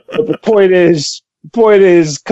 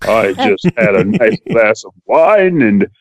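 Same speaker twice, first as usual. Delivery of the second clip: low, measured, debonair gentleman; dignified voice; 9 semitones lower than usual. Shown as a demonstration. Co-host greeting the listeners after code switching, dignified. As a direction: put on a deep, dignified, debonair voice. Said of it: "That's a dignified voice. That's, that's debonair".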